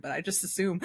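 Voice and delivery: ordinary talk, nothing marked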